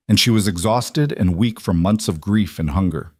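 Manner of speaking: ordinary delivery, nothing marked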